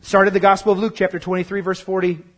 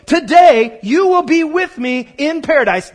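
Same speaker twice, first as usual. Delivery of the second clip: preaching shout; 5 semitones higher, 6 dB louder than usual. Acted. Preaching Jesus' promise loudly.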